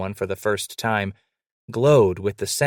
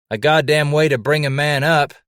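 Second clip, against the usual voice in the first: grumbling, louder and higher complaint; growled; 6 semitones higher, 5 dB louder than usual. A narrator's reading. Woken trapper grumbling at his rude wake-up, growled. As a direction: growl it out, freshly woken and grouchy. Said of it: "Scratch growled".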